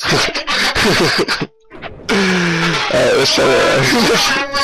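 words broken up by laughter; helpless laughing. Real, not acted. Player proposing another attempt after failing, laughing. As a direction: try to talk while cracking up.

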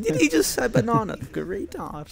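high, amused disbelief, then dropping off; a hint of retold incredulity; mock disbelief. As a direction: play up the shock at the word. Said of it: "And I was like".

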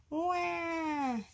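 put-on high, drawn-out baby "wah"; sustained high-pitched mock baby cry; baby wail. A narrator's reading. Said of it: "yelled the baby ghost".

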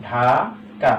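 Dhaka in two beats, "ঢা", pause, "কা"; split into syllables. Shown as a demonstration. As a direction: draw out the first syllable of Dhaka, then stop. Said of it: "আমাকে দুইবার উচ্চারণ করতে হচ্ছে". (ঢাকা দুই ভাগে থেমে উচ্চারণ)

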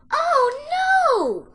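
very high, loud piglet wail; high-pitched scream of dismay. A narrator's reading. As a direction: squeal out in alarm, long and high. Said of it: "screamed the piglet".

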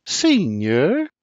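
butter him up sweetly; wife coaxing her father-in-law sweetly; sweetest voice. A narrator's reading. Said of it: "in her sweetest voice says".

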